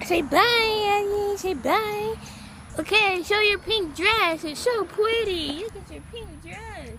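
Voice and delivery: high, slow sing-song pet talk. Real, not acted. Owner cooing to her dog Ellie on their anniversary, baby talk.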